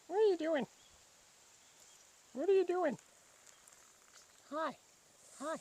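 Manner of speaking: very high, falling playful question. Real, not acted. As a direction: coo it up high at the kitty. Asking the cat playfully.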